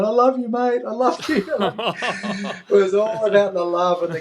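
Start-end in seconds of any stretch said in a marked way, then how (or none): 0.0-1.5 tender love. Retold or imitated. Father to son at finish. 1.5-2.6 a real warm laugh that spills over after the words; happy laugh. Real, not acted. A father laughing with joy at the finish line, happy laugh.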